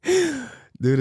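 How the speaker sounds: put-on high, squeaky little-kid "What?"; toddler voice. Dad voicing his little son asking back, high squeaky kid voice. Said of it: "he's like".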